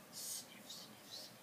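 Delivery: breathy whispered sniff, sniff, sniff; soft unvoiced sound effect. A narrator's reading. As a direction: hush it like a sniffing nose.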